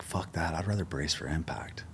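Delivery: hushed, unvoiced quip under the breath; muttered aside. Himself, scoffing at the group motto, whispered aside. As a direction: whisper it like a private joke. Sarcastic inner retort to phrase.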